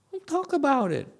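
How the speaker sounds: high firm rebuke; high, sharp don't talk about it. Real, not acted. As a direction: cut them off firmly.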